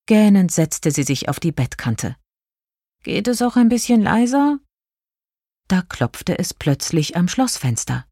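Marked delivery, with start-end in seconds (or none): 3.0-4.6 put-upon princess, higher voice; mild complaint. A narrator's reading. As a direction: protest wearily, voice pitched up. Woken princess complains to noisy mice.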